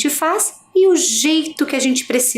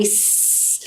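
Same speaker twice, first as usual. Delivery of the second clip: a soft voiceless "s" hiss; just hiss the "s" quietly; hissed s sound; 8 dB quieter than usual. Shown as a demonstration. Teacher showing the sound of the letter, hissed. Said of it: "com o som ali".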